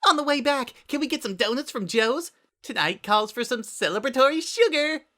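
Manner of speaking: high, bubbly and eager; Pinky voice. A narrator's reading. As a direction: chirp it out with sugary glee. Pinky character voice.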